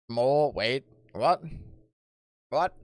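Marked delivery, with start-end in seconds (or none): none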